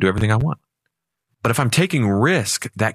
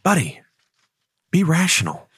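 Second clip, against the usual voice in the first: higher, soothing cartoon angel voice; angel Donald Duck; 5 semitones higher than usual. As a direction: coax him back to reason like a little angel. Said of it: "the angel's on the other side saying".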